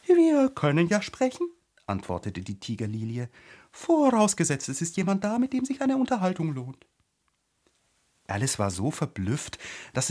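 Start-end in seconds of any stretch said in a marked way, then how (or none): 0.1-1.5 high, loud flower voice; pitched-up talking tiger lily; haughty talking flower. A narrator's reading. Tiger-lily answers in figure voice. 3.7-6.9 pitched-up talking tiger lily; haughty talking flower. A narrator's reading. Haughty Tiger-lily answers Alice.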